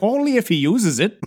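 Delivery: high-pitched imitation of a German scientist; German professor. German professor answers about the danger.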